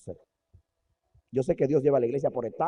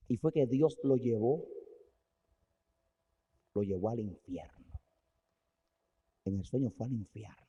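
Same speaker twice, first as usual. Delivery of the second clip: hushed telling; 6 semitones lower, 5 dB quieter than usual. A narrator's reading. Dream of hell told gravely.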